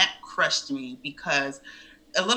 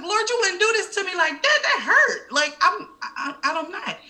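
much higher, mock-pleading protest with a laugh; mock anguish; 5 dB louder than usual. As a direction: plead with God in hammed-up disbelief. Waking from the pregnancy dream.

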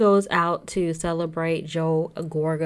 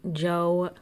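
ordinary delivery

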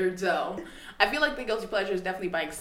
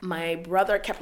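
normal talking voice

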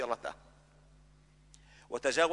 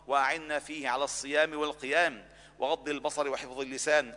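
fervent prayer; 3 semitones lower, 4 dB quieter than usual. Acted. Imam leading the congregation in closing dua, fervent prayer. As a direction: plead with God on behalf of the congregation. Imam's closing supplication, rising fervour.

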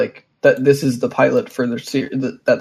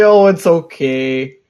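imitating a polite Canadian shrugging off a shooting; mock polite Canadian; 3 semitones higher, 5 dB louder, slower than usual. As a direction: drawl it out like a too-polite Canadian. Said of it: "You're like".

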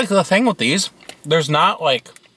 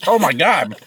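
chewing sticky gummy; 4 semitones higher than usual. Real, not acted. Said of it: "It's, like, stuck in my teeth".

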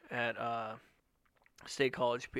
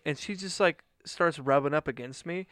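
ordinary talk, nothing marked

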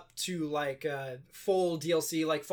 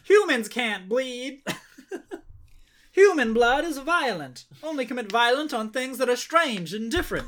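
mock solemn; 6 semitones higher, 4 dB louder than usual. Mocking the ratings board logic.

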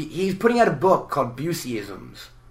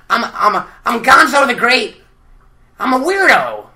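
Gonzo impression; 9 semitones higher, 8 dB louder than usual. Gonzo introducing himself, high loud voice. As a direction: boast like a proud oddball. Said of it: "The tough one ... is Gonzo".

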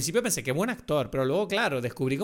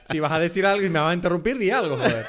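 laughing mock complaint; 3 semitones higher, 5 dB louder than usual. Real, not acted. Mock complaint about being interrupted.

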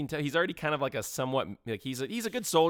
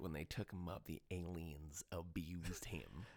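much softer, slow and lower; hushed retelling; 7 semitones lower, 17 dB quieter, slower than usual. Retold or imitated. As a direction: drop to a hush, slowly. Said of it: "It's like".

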